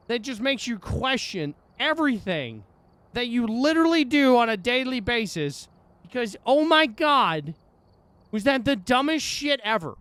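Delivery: real fed-up rant; angry rant. Real, not acted. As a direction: vent the frustration, building. Ranting after losing the round.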